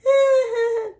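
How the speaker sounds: loud, high-pitched laugh. Acted. Laughs at own advice.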